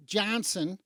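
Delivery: normal talking voice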